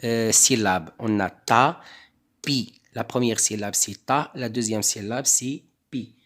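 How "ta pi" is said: TA, pause, PI as two separate beats; split into syllables. Shown as a demonstration. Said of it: "on va composer de deux".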